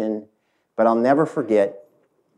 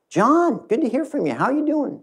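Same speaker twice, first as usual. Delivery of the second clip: much higher, light and friendly woman's voice; cheerful female investor. Retold or imitated. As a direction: greet him brightly from way up high. Voicing the female investor on phone.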